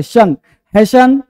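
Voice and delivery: शन, हैशन drawn out slowly with 'sh'; silent T. Shown as a demonstration. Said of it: "इसे शन पढ़ते हैं". (शन, हैशन, धीरे 'श' के साथ)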